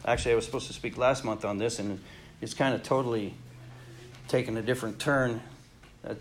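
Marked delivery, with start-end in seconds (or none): none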